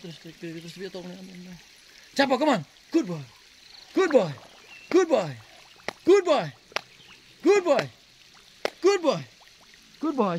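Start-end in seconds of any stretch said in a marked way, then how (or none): 2.2-10.4 very high, squeaky dog praise; squeaky praising a dog. Real, not acted. (suara cempreng memuji anjing)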